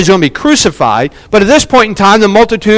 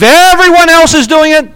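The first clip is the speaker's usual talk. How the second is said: mimicked crowd-follower; 8 semitones higher, 3 dB louder than usual. Mimics people who follow others.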